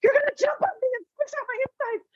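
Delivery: very high, fast, crying panic; imitating Leo Bloom's frantic terror; terrified squeal. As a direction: shriek it like you're about to be crushed. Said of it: "he goes".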